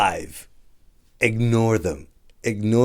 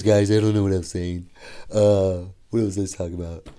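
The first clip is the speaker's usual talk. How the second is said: usual speaking voice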